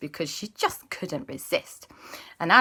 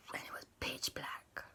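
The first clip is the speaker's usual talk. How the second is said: spooky whisper; 18 dB quieter than usual. A narrator's reading. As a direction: whisper it into the dark. Spooky storytelling hush.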